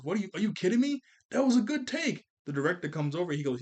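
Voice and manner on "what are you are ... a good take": higher, louder disbelieving protest; mad outburst. Retold or imitated. Beast Boy protests the redo to the director, mock angry. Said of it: "gets really mad because he goes".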